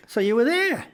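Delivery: high, loud mock questioning; oblivious older passenger. Older passenger questioning the comedian, high loud oblivious voice. Clueless passenger replies, retold.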